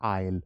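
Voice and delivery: I'll said as one syllable, "ail"; teacher's model of I'll; contracted I'll. Shown as a demonstration. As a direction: run I'll together as one sound. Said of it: "pronounce कैसे करोगे".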